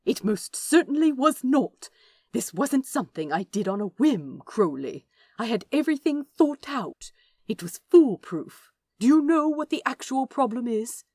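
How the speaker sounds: indignant lecture. Acted. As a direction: scold him like a schoolmaster. Angel lecturing in the quarrel.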